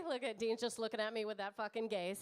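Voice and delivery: ordinary talk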